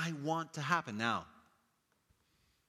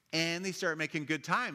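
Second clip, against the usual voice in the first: normal talking voice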